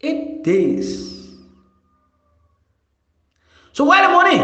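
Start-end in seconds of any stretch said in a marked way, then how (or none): 3.7-4.4 raised, pressing demand; demanding question. Real, not acted. Demanding where the money went.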